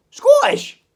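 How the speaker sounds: high loud Scottish "Scottish?". Acted. Arguing with a mate over which accent, a loud Scottish query. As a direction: bark "Scottish?" in a high Scots voice. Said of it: "I'll go back to South African".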